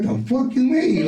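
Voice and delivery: high-pitched, drawn-out indignant retort; incredulous, offended comeback, replayed for listeners; retold offense. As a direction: act out being deeply offended, pitch shooting up. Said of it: "I was so offended by the shit".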